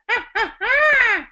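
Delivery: high, loud witch cackle; witchy voice. Shown as a demonstration. Voice teacher demonstrating a witchy cackle for students. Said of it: "and we'll take that".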